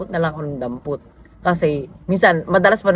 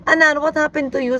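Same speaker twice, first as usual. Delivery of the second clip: much higher, alarmed mother's question; concerned surprise; 10 semitones higher than usual. Retold or imitated. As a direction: ask the teary son what happened, startled. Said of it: "Sabi ko". (mataas at gulat na tanong ng nanay)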